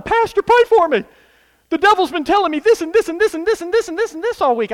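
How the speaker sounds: high, fast complaining plea; whiny churchgoer. Retold or imitated. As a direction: mimic a pestered believer whining. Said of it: "I talk to people all the time".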